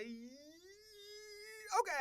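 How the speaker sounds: high, long sung okay; drawn-out okay. Real, not acted. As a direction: stretch it out.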